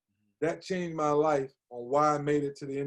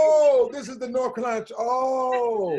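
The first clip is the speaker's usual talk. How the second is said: shouted, much higher and louder; mock excitement; 8 semitones higher, 7 dB louder than usual. [name] hamming up being wowed on his visit, shouting high. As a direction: burst out as if wowed by the campus.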